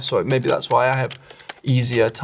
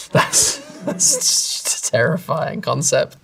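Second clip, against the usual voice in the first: laughing dread; 5 semitones higher, slower than usual. Real, not acted. Still laughing at his future age.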